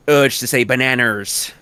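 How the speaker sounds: ordinary delivery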